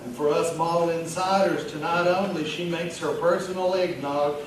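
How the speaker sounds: chatty pet-store manager at a bar; Vernon Ricketts. Acted. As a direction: play a festive mall regular chatting up a stranger. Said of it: "read it in the voice of the character".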